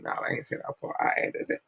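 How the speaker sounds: a quick whispered aside, almost breathless. Real, not acted. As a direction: drop to a whisper to confess the joke. Aside disowning the quoted word.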